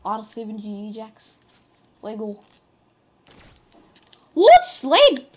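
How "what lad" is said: loud, high shout; shocked shout. Real, not acted. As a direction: shout in disbelief. Surprised in game.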